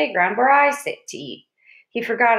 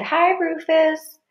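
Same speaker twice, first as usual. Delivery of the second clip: high, slow, sing-song greeting; drawn-out girlish hello; lightly voiced schoolgirls; cooing girls; 8 semitones higher, much slower than usual. A narrator's reading. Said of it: "girls thought he was cute".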